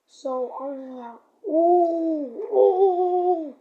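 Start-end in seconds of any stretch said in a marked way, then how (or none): 1.5-3.6 loud, high hooting taunt; taunting yell. Retold or imitated. A boy taunting the man with loud hoots. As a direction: hoot it out to provoke him.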